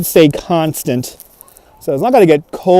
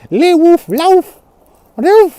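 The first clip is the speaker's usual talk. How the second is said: high mock barking words; imitating a barking French dog, high-pitched; 12 semitones higher, much slower than usual.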